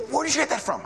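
mock astonished question; surprised professor. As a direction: imitate the startled professor. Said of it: "And he goes".

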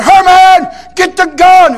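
high, loud yell for help; retold full-voice shout; desperate holler. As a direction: holler for the gun as a bear has you. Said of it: "And I, and I hollered".

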